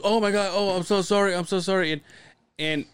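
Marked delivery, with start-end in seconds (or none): 0.0-1.9 higher, flustered sorry; mock apology. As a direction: apologize like a panicked kid. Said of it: "he goes".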